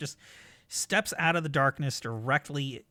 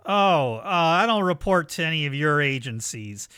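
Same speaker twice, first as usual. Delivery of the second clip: loud villain's reply across a distance; loud, dismissive answer; calling back; 3 semitones higher, 7 dB louder than usual. Acted. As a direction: call it up to them, projecting. Villain calls back up to players.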